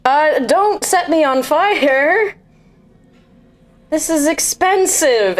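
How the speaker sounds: high, slow panicked plea; alarmed protest. Acted. Character alarmed about her coat.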